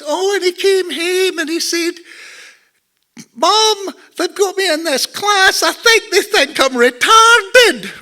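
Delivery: high, loud Glaswegian mother; imitated Scottish mum. Mum telling her son's complaint at parties, high imitated Scottish voice. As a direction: mimic mum piping up, pitched right up. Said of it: "my mum said, you know, she would be at parties".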